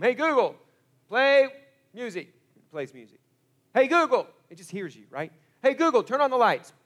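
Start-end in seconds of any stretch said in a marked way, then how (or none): 0.0-2.3 louder, higher voice to the speaker; calling to a device. Retold or imitated. Talking to a smart speaker. 3.7-4.3 louder, higher voice to the speaker; calling to a device. Retold or imitated. Talking to a smart speaker. 5.6-6.7 louder, higher voice to the speaker; calling to a device. Retold or imitated. Talking to a smart speaker.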